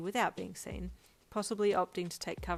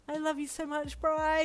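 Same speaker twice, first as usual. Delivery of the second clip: cried out; 10 semitones higher, 5 dB louder than usual. Acted. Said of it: "you cried".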